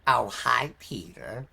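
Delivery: lazy cartoon neighbour greeting; Cleveland from Family Guy. Streamer doing Cleveland greeting his neighbour. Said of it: "Cleveland from Family Guy".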